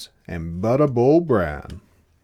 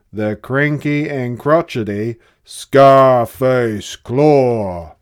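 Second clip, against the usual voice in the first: loud, slow, menacing build; 9 dB louder, slower than usual. A narrator's reading. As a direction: boom out the villain's name. Menacing villain cat introduced.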